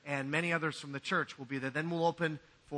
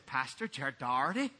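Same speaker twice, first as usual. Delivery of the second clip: Scottish brogue; 4 semitones lower than usual. Pretend. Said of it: "in a Scottish brogue".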